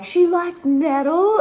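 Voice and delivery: high, loud, slow mock amazement; imitating the guys' thrilled surprise; excited guys. Boys at party discover she likes metal, mock-excited squeal. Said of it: "they were just like".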